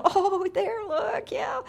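put-on high cooing baby talk; high-pitched doting coo; cooing at a pet. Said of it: "And we all just go".

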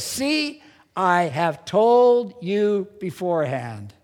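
slow, weighty delivery of told; solemn quote. Shown as a demonstration. Pastor quoting Jesus to the church, slow and weighty. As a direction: lean on "told" and draw it out. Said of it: "quoting Jesus with slow weight".

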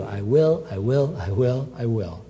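whisper-close reassurance to one listener; soft promise. Acted. Preacher voices God's gentle promise.

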